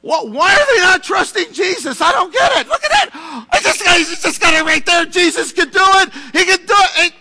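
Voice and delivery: high, loud bewildered angel voice; confused angel. Retold or imitated. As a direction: play a baffled angel in heaven. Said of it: "can you see them sitting up there in heaven".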